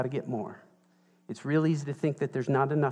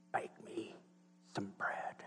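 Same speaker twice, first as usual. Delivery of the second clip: creepy whisper; 6 semitones lower, 19 dB quieter, slower than usual. Retold or imitated. Creepy hairy guy at gates.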